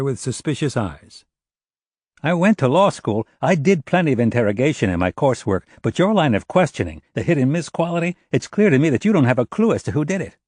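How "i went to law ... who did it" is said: put-on high, emphatic, challenging young lawyer; indignant witness. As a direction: take the investigators to task, pitched up. Narrator voices Jared challenging the investigators.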